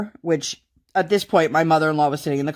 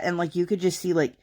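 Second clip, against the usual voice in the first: ordinary talk, nothing marked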